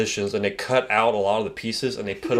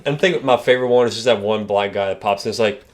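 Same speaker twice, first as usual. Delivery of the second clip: normal talking voice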